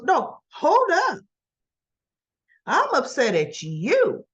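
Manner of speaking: slow, emphatic pushback, high at the start; acted indignation. [name] turning on Summer, acted indignant pushback. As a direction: stop them and push back slowly.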